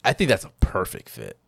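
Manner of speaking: ordinary talk, nothing marked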